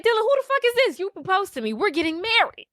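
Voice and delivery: high-pitched acted outrage; indignant confrontation. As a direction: play the betrayed fiancee demanding answers. Acted confrontation with cheating fiance.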